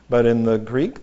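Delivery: ordinary delivery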